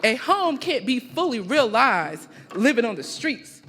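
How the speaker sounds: loud, emphatic live poetry recital with measured pauses; spoken-word poem. Acted. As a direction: declaim it line by line, letting each phrase land.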